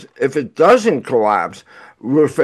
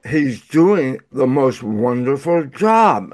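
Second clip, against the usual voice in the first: usual speaking voice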